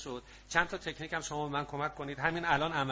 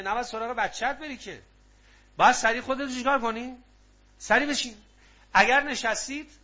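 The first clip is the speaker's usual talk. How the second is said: loud, high, driving sermon voice; fervent preaching; 4 semitones higher, 6 dB louder than usual. Acted. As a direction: preach it with full force. Preaching at full force.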